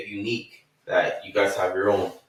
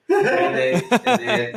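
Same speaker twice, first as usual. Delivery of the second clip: laughing; 11 semitones higher, 3 dB louder than usual. Real, not acted. Storyteller cracking up.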